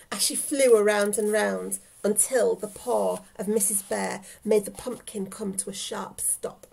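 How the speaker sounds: plain reading voice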